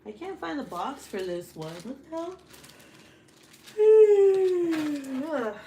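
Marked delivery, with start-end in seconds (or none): 3.7-5.4 big loud groaning sigh; heavy frustrated exhale; loud exasperated sigh. Real, not acted. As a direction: let out a huge fed-up sigh. Frustrated, can't find box.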